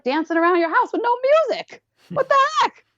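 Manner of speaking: high, squealed astonishment; gleeful hammed-up incredulity; mock amazement. Mock amazement at dancing without music.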